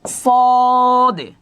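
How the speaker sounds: long held high সদে with stretched vowel; drawn-out sad. Shown as a demonstration. Teacher to class, slowly stretching the letter name. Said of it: "সদটা কিভাবে পড়ব?".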